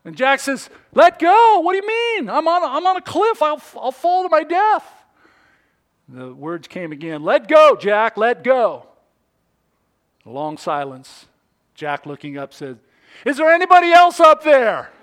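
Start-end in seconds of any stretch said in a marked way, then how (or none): 0.9-4.9 very high, loud protest; panicked disbelief. Acted. Climber panics at God's instruction. 7.2-8.9 loud call, slowing at the end; commanding voice. Acted. God repeats the command from above. 13.2-14.9 high, very loud call; desperate yell. Acted. Climber yells for another rescuer.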